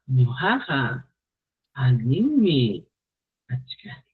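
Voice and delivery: each word drawn out slowly, fading at the end; slow deliberate Quechua with the suffixes stressed; slow, weighty phrasing. Shown as a demonstration. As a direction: lay it down slowly, word by word, trailing off. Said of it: "le da otro sentido".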